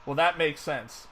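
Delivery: ordinary delivery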